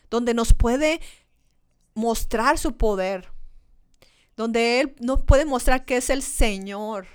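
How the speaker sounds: loud, rising sermon delivery; full-force preaching. Acted. Pastor urging the church to follow Jesus, preaching loudly. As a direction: preach it out to the whole congregation.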